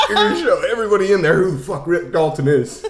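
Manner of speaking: fast, loud mock self pep talk; Rick Dalton. Actor hypes himself up in the mirror, fast mock determination. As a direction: rattle off a hammed-up vow, half laughing. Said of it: "You're really good at this impression".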